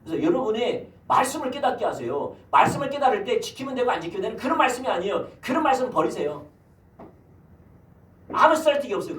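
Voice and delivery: high, loud preaching cadence; fervent preaching. Acted. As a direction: preach it out to the congregation. Preaching at full force.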